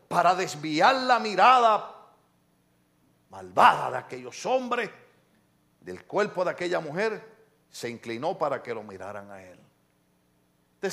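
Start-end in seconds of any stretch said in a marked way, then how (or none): none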